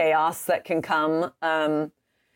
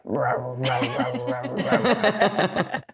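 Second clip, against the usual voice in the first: low mock dog grumble; grumbling dog; 5 semitones lower, much slower than usual. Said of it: "but she's like".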